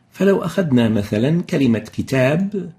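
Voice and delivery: ordinary delivery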